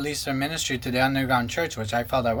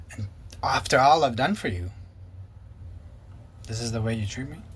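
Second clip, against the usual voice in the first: mock guilt trip; 5 semitones lower, 4 dB quieter than usual. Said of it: "he'd guilt trip me".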